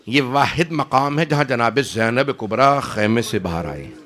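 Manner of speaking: ordinary talk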